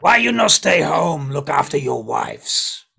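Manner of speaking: very loud, slowing goblin voice; scolding goblin. Acted. Goblin tells explorers to stay home, loud scolding. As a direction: tell them off as a big loud goblin.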